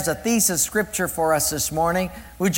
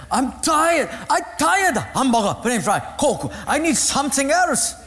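put-on Japanese accent; 7 semitones higher than usual. Man complains to teacher.

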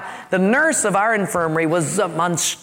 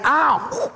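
mock ow; 7 semitones higher than usual. Mock pain.

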